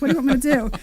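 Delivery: loud, exasperated retort. Retold or imitated. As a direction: throw up your hands at him. Retold reply to husband.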